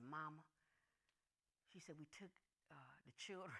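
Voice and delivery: low, hushed, grave daughter; quiet. Retold or imitated. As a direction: break bad news in a low, soft voice. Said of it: "they was quiet".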